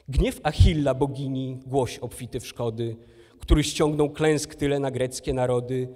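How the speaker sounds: loud, forceful declamation with weighty line openings; epic verse recitation. Acted. Recites the Iliad translation. (donośna deklamacja eposu)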